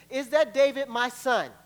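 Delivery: loud surprised call. Acted. Saul calling back to David, loud and surprised.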